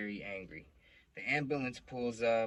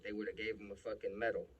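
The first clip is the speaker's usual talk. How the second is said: usual speaking voice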